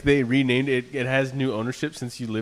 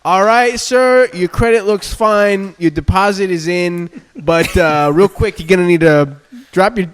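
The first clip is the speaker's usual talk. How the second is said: a lightly voiced, higher, louder sleazy leasing clerk; playing the apartment office guy, a bit higher and louder; mock leasing agent; 6 semitones higher, 7 dB louder than usual. Mocking the apartment clerk to a friend, a lightly higher louder voice.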